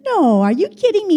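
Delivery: high, loud retold disbelief; mock disbelief. Retold reaction to birthday coincidence.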